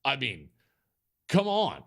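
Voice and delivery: usual speaking voice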